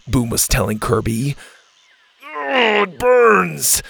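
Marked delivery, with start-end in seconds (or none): 2.2-3.8 high, drawn-out groan of pain; pained moan. A narrator's reading. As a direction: moan it like a wound is burning. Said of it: "Kirby moaned".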